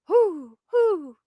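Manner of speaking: high hooting owl imitation; mock owl call. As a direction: hoot like an owl.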